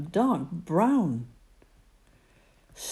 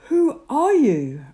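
startled Moses; 9 semitones higher, 9 dB louder than usual. Acted. Moses questions the voice.

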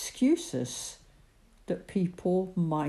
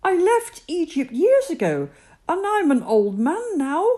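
startled Moses; 12 semitones higher, 8 dB louder than usual. Acted. Moses protests to God.

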